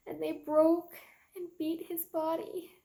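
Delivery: high, anguished testimony swelling on the outbursts. Acted. Mary Magdalene recounting the soldiers beating Jesus, anguished. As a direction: pitch rising as grief takes over.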